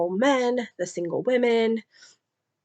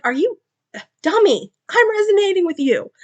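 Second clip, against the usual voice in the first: high, louder teasing "dummy"; exasperated reassurance; 11 semitones higher, 5 dB louder than usual. Retold or imitated. Mate telling Kira she is the one, high teasing. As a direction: laugh at her fear and set her straight. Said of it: "He's like".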